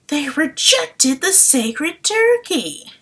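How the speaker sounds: slow, high, loud relish; excited. Retold or imitated. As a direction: savor the line with glee. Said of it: "it does give us".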